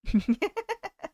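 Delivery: high-pitched little laugh; giggle. Real, not acted. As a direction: let out a light, high giggle. Chuckles after joking reply.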